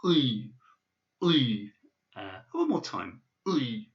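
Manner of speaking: "Oi" with the tongue sliding back down the throat; "Oi" said slowly and swallowed; practice oi. Shown as a demonstration. Teacher repeating "Oi" for students. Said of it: "do practice saying it".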